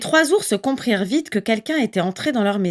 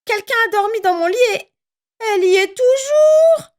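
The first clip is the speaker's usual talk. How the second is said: much higher, fast, rising to a louder cry; little bear finding the girl, tiny voice getting louder; Baby Bear's tiny voice; 13 semitones higher, 4 dB louder than usual. A narrator's reading. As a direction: squeal it like a startled cub. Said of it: "dit Bébé Ours de sa toute petite voix".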